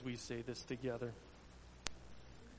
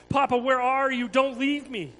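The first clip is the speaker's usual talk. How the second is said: higher, louder desperate plea; frantic cry; 10 semitones higher, 12 dB louder than usual. Acted. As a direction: beg his father not to leave. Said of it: "Jesus cried frantically".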